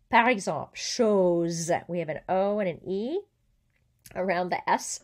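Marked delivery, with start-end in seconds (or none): 0.7-1.7 CHOSE said "shohz", the Z held. Shown as a demonstration. Teacher giving an example, S as Z. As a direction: buzz the end of chose. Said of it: "One S between two vowels has a Z sound".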